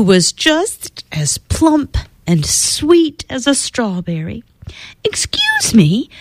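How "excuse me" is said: brightly. Acted. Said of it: "she said brightly".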